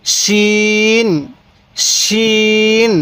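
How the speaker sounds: শিন with a thick sh, vowel held long; teacher's slow, thick-sounded শিন; thicker শিন. Shown as a demonstration. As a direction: draw out শিন on a level note with a full sh. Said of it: "শিনটা একটু মোটা করে উচ্চারণ করব".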